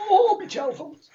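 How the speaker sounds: high, slow complaining whine; whiny old duke. Acted. Duke complains about his piles.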